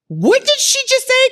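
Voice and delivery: high, incredulous mock reaction; stunned disbelief. Acts out stunned audience reaction.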